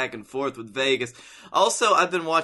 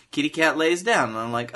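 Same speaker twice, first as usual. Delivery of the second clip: ordinary talk, nothing marked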